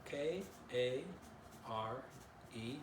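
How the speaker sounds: usual speaking voice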